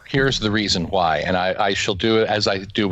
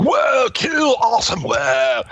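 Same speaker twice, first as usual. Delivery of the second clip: put-on wowed teen; 12 semitones higher, slower than usual. A host imitating a young reader, gushing in an excited shout. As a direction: gush like a teen reading it first. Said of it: "you're like".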